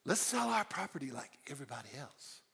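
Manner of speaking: a couple scheming in hushed voices; scheming conspirators. Pretend. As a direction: whisper the plan like co-conspirators.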